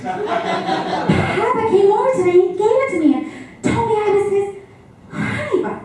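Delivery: high-pitched imitation of a greedy kid; acted little child. Child answers with mouth full, acted.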